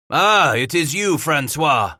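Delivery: high, loud answering shout; shouted back. Acted. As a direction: shout back at the hiding man. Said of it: "Vivi shouted back".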